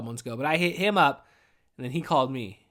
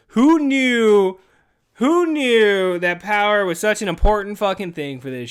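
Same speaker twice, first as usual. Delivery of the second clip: louder, slow exasperated sigh; 4 semitones higher, 8 dB louder, slower than usual. Real, not acted. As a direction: drag it out fed up. Exasperated sigh about power.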